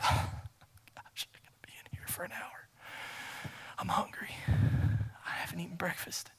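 weary, reluctant whispered grumble; grudging whisper. Acted. Churchgoer dragging through an hour of prayer, grudging whisper. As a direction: whisper it like you'd rather not be there.

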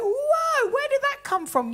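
high, loud mock shock; imitated startled challenge. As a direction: call out a surprising remark in high voice. Acting husband's startled challenge.

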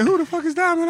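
very high, loud outrage; mock outraged. Man on the phone demanding who Diamond is, high and loud. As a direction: spit the question out. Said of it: "he said".

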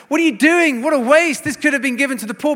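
high, loud mock outrage; horrified outburst. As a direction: voice a horrified objector, quickening at the end. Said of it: "Judas Iscariot is horrified".

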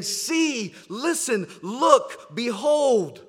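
rising, urgent calls to look; awed, building exclamations; overwhelmed exclaiming. Retold or imitated. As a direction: stir them to look and see. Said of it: "He's overwhelmed".

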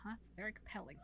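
very quiet, understated acted reply; intrigued murmur. Witch weighing an offer to kill her rival, intrigued murmur. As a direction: play her quietly tempted, barely voiced.

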